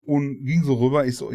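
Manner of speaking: ordinary talk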